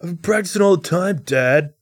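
put-on high pleading kid voice; mock whiny teen; whiny son. Son pleads with angry dad.

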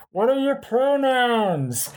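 high, drawn-out imitation of a far-off shout; roommate's yelled question, imitated without full volume; mock yell back. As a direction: call it back from another room, stretched and high. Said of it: "my roommate Steven just sort of yelled back".

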